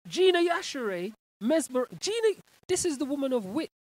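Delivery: high, loud dramatic reading; theatrical reading. Acted. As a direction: read it out like a grand announcement. Host reads fan comment theatrically.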